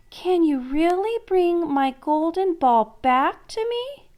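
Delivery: high, hopeful princess voice. Acted. Princess asking the frog for her ball, high and hopeful. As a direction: ask as if the ball might come back. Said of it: "the princess asked hopefully".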